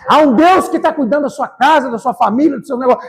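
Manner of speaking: impassioned preacher at a climax; full-force preaching. Real, not acted. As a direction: shout the promise out to the whole church.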